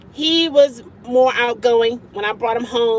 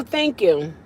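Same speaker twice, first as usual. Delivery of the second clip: ordinary delivery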